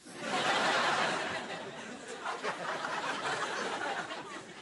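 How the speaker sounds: airy wheezing laugh; breathy laugh. Real, not acted. Host laughs at own joke.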